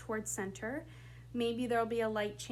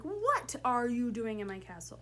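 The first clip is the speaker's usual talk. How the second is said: "What" punched out first, the rest falling away; stress on What; 2 semitones higher, 4 dB louder than usual. Shown as a demonstration. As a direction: lean hard on "What". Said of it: "pick one word in that sentence to really emphasize".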